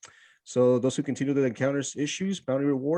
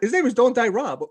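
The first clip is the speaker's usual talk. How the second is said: excited; 10 semitones higher, 6 dB louder than usual. Real, not acted. Joking nickname announcement.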